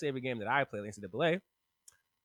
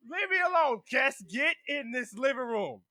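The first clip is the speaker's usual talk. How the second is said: retold yelling match; 9 semitones higher, 4 dB louder than usual. Cass is ordered into the living room, loud mock fury. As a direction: act out yelling between rooms. Continues acted yelled exchange.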